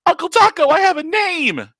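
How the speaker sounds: put-on a little girl squealing her uncle's name; excited child. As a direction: kid throwing herself at her uncle, squealing. Child character protesting.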